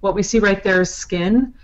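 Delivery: normal talking voice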